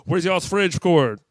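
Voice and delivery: slow, loud retold question; retold loud demand. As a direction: imitate a loud, drawn-out question. Visitor demanding a cord in story.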